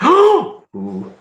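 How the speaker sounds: high gasp turning into a thrilled ooh; delighted gasp. Real, not acted. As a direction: gasp with real delight at a bargain. Reacts to cheap dinner special.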